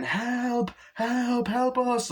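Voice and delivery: very high, put-on plea; mock cries for help. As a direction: imitate game characters calling for rescue. Mimics video game cries for help.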